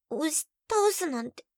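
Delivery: startled when pushed down; flustered surprise; surprised. Acted.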